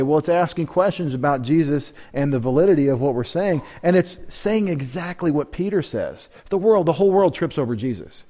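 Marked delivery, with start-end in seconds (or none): none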